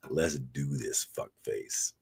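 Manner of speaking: low, hushed challenge; imitating a quiet, ominous dare; mock menace. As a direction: drop low and quiet like a retold threat. Quoting friend's menacing challenge.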